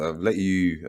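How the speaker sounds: ordinary delivery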